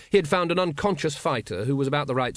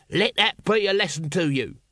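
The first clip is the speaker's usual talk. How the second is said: higher-pitched mock-stern scolding; hasty admonishment; 5 semitones higher than usual. A narrator's reading. Small corporal cautions waking brawlers, admonishing. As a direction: scold them like an unconvincing little policeman.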